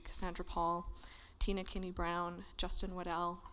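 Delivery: ordinary talk, nothing marked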